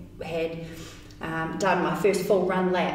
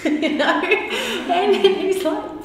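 laughing; 12 semitones higher, 7 dB louder, much slower than usual. Real, not acted. Laughing about her husband.

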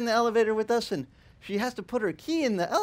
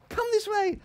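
much higher, bright welcoming lady; hostess voice. Retold or imitated. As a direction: lift the voice up to a hostess leading guests. Voicing Bernice as host.

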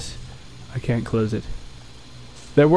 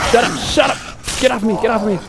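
panicked shout; 5 semitones higher, faster than usual. Real, not acted. Player swarmed by enemies yelling to get off. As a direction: shout them off you.

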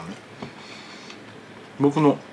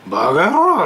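loud, higher mock shout; angry yell; 7 semitones higher, 7 dB louder, much slower than usual. Old customer yelling after the barber. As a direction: bark it out like a cranky old man. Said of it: "でっかい声でだよ".

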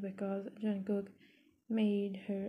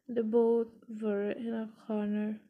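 ordinary delivery

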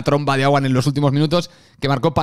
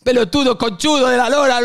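mocking Argentine fan; 9 semitones higher than usual. Fan mocking the Galician accent, jeering Argentine shout. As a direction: jeer at the Galician like a furious hincha. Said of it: "Abrí la boca".

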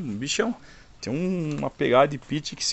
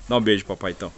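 ordinary delivery

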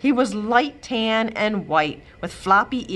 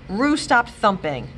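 ordinary delivery, nothing marked

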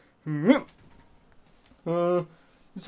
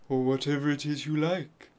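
ordinary talk

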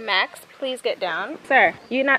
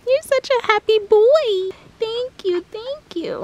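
high cooing pet voice; 11 semitones higher, slower than usual. Real, not acted. Greeting the happy dog, high baby talk.

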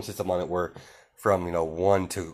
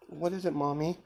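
a hint of a small child, pitched higher; lightly voiced little boy; 8 semitones higher, 4 dB quieter than usual. Little Izuku asking his mother, lightly voiced higher child. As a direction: ask like a curious little kid.

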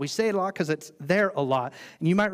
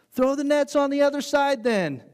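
high, louder casual instruction; Jesus instructing; 10 semitones higher, 6 dB louder than usual. Retold or imitated. Jesus telling fishermen where to cast, high and loud. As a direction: toss out the tip, high and easy. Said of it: "and he's like".

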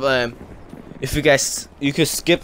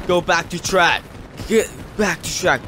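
ordering guard; 5 semitones higher than usual. Retold or imitated.

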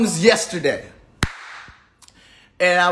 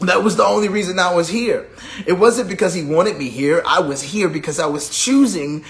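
ordinary talk

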